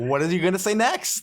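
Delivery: "next" rising high and loud; eager upward question. Acted. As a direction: push "say next" up as if hooked. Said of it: "just with an upward inflection".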